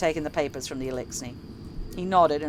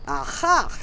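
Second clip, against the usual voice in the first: sudden delighted cry; excited cry; 10 semitones higher, 6 dB louder than usual. A narrator's reading. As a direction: shout it out in discovery. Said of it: "he cried".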